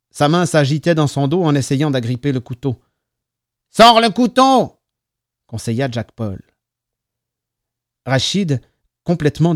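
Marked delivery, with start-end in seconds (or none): 3.7-4.8 high, loud, slow shout; raised, stretched urgent instruction; urgent command. A narrator's reading. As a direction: call out the order, drawing it out. Urgent command during the fight.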